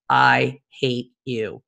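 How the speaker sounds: very slow, low, word by word; deadpan text reading. Retold or imitated. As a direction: spell out the verdict slowly. Reads out his text reply.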